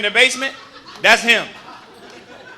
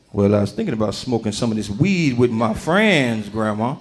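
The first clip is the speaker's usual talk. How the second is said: much lower voice for his younger self; 12 semitones lower than usual. Retold or imitated. Grandson telling grandma his weed plans. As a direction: drop low as the boy answering Granny.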